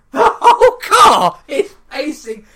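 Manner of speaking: half-yelling in disbelief; laughing outrage. Real, not acted. Telling listeners how badly she parked, laughing loudly. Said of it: "I'm gonna make this live for you".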